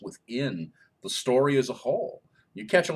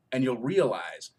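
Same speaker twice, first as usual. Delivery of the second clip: normal talking voice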